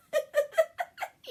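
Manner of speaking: real laugh at the memory; high laugh. Real, not acted. Laughing at own story.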